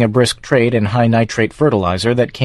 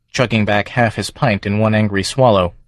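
plain reading voice

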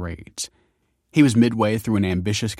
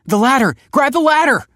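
much higher, louder urgent call; 10 dB louder than usual. A narrator's reading. Grandson calling to the rescuer in the river, shouting. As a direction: yell across the water. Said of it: "called Michael".

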